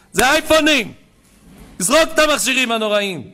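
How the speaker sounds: loud, high fiery exhortation; preaching at full force. Acted. Rabbi thunders at the congregation to throw away their iPhones. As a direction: rail against the devices from the pulpit.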